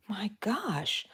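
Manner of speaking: breathy mock aside whispered to herself. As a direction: tease herself under her breath.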